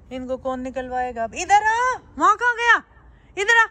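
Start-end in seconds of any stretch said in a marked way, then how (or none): 1.4-3.7 very high, loud calling. Real, not acted. Owner calling her wandering pet back, high loud shout. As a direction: shout it back to you, voice pitched way up.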